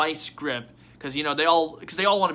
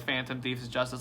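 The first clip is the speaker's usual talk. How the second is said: ordinary talk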